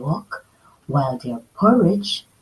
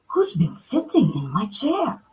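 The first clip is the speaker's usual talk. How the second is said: softer, whispered wondering; Mama Bear wondering; 4 semitones higher, 4 dB quieter than usual. Acted. Mama Bear wondering about her chair, whispered. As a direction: puzzle over it quietly. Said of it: "wondered Mama Bear".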